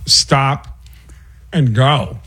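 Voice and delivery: halting impression with long odd pauses; stop-and-go put-on celebrity voice; Christopher Walken. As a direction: stop dead mid-sentence, then resume. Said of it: "Christopher Walken is got a great".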